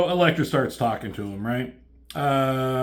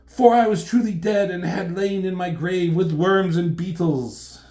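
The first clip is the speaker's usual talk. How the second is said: raised, mock-tragic declamation; grandiose tragic heroine; 5 semitones higher, 4 dB louder than usual. Elektra recalling her own grave, hammed-up tragedy. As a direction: mourn it theatrically, easing off at the end.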